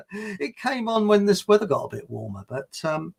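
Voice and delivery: ordinary talk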